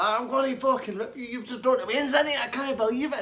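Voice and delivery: high, loud yelled threat; furious threat. Retold or imitated. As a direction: scream it like you're lunging over the bar. Dave still threatening barman.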